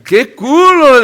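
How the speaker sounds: high loud admiring catcall. Retold or imitated. Imitating men complimenting her bottom, high loud. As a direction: shout it up high like a man in the street.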